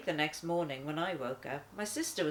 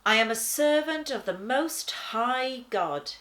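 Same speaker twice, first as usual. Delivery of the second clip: lifted, louder solemn angel; 6 semitones higher, 8 dB louder than usual. Acted. Angel proclaiming himself to the lion, solemn and loud. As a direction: announce it like a heavenly servant.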